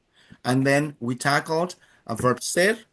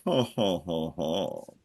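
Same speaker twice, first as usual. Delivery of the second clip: soft ho ho falling low; playful ho ho laugh; 9 semitones lower, 5 dB quieter, slower than usual. Real, not acted. Teacher amused while prompting students, laughing. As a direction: chuckle "ho, ho" playfully.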